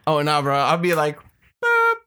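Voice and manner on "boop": a short high honk sound; mock honk. Friend imitating his polite little honk, high beep. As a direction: squeak out a tiny car beep.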